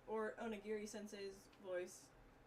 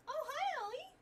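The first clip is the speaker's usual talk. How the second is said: high cutesy pet voice; 10 dB louder than usual. Real, not acted. Greeting his cat.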